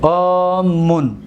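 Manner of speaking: drawn out, word by word; slow demonstration. Shown as a demonstration. Teacher to students, slowly spelling out the form.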